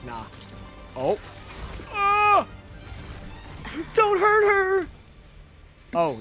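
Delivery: ordinary delivery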